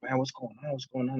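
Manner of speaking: hushed, quick self-questioning; bewildered muttering. Retold or imitated. Man feeling suddenly hot and sick, bewildered and hushed.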